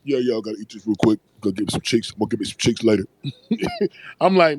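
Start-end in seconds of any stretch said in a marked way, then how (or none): 0.0-3.0 lower, mock-casual voice quoting a friend; imitating Jay. Teller mimics friend's boast about getting cheeks, low and fast. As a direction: drop low and toss it off like him.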